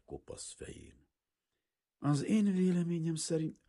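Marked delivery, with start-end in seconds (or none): 2.0-3.6 fussy father. A narrator's reading. Father tries to continue.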